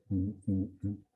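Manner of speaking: ordinary delivery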